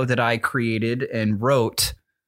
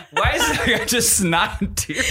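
laugh-crying; 8 semitones higher than usual. Real, not acted. Eyes burning, laughing and crying.

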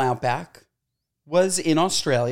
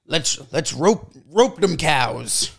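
mock cowboy; slower than usual. Imagining steakhouse cowboy talk.

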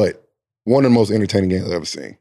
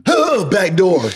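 high, sudden mock alarm on court; startled exclamation; 10 semitones higher, much slower than usual. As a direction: gasp and call out the cut, re-enacted. Acts out getting beaten on defense.

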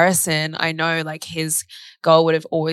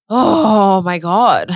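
long drawn-out, loud "oh my God"; slow, stretched gasp of realisation; retold amazement; 3 semitones higher, 7 dB louder, much slower than usual. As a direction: draw it out, overwhelmed, as if retelling. Retold amazed reaction to therapist.

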